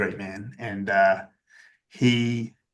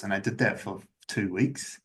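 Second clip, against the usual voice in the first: ordinary talk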